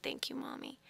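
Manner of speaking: tiny, hushed near-whisper; tender child's thanks. Retold or imitated. Little boy hugging his mother tight, whispered thanks. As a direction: breathe it out very softly, as a little child.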